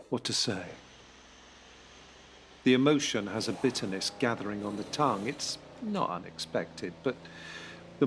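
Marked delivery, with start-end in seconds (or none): none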